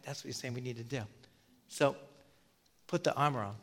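normal talking voice

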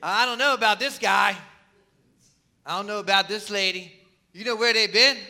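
mock wary, sizing up a stranger; suspicious judging voice. People sizing up a stranger, in a loud suspicious tone. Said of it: "we size up people just like they did".

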